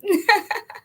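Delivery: short high laugh; a real short laugh. Real, not acted. Laughing after here I am.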